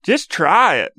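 drawn-out bumpkin pleading. Acted. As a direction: coax like a mock country believer. Continues the acted hick heckler.

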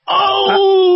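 sudden high yelp of alarm; a startled oh, as if caught out; panicked realization mid-read; shocked gasp. Retold or imitated. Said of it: "and I'm like".

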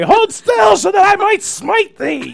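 mock biblical prophet. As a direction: bellow it like a vengeful knight. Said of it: "I think of Cable Guy".

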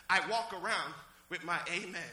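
ordinary talk, nothing marked

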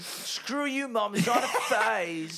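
put-on high, drawn-out teen whine through laughs; mock rebellious teen. Friend play-acting a teen yelling at mom, high mock whine. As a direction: wail it at mom like a dramatic teenager.

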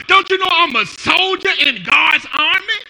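loud defiant cry; defiant shout. Acted. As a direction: throw it back at the enemy. Preacher voicing defiant believer.

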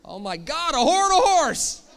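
mock horror, high and very loud; mock terror. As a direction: shriek it in pretend panic. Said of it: "How scary would that be?".

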